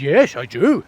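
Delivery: loud, swooping talking-dog voice. Acted. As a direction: answer as a goofy talking dog. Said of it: "it's mandatory to talk in that voice when you're doing the voice of a dog".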